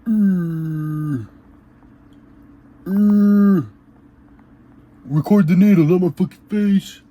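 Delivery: high, loud, pained yelps; strained in pain. Real, not acted. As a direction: cry out through the sting of the needle. Injecting oil, in pain.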